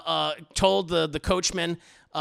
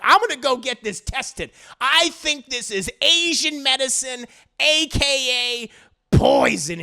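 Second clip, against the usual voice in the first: loud, higher resolve; loud declaration; 6 semitones higher, 7 dB louder than usual. Acted. JoJo telling Dio he'll test the poison, loud. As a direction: announce it firmly and loud.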